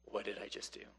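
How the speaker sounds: low, soft, stunned murmur; dismayed Gideon. Retold or imitated. Gideon asks himself what he has done in a soft stunned voice. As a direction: realise the mistake under your breath. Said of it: "he's like".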